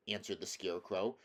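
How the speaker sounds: plain reading voice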